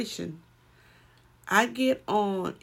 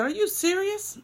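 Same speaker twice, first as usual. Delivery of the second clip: shocked question jumping much higher; in disbelief, pitch leaping up; imitating her own astonished reply; retold surprise; 11 semitones higher than usual. Retold call, friend's car failed.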